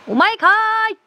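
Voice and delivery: very high, loud comic yell; mock outraged retort. Streamer heckling the same old character, high mock shout. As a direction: shriek it like a comedy comeback.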